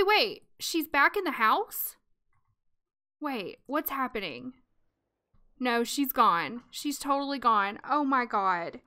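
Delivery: ordinary delivery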